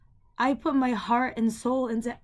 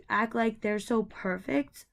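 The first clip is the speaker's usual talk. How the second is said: normal talking voice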